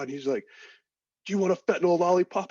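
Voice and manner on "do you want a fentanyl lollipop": loud, high-pitched mock offer; excited medic imitation. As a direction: call it out like a frantic medic in a retelling. Voicing the medic offering pain relief.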